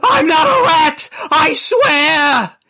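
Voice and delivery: very high, loud, crying shout; tortured shouting oath; screaming denial. Acted. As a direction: scream it through the pain. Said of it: "Chris kept on swearing".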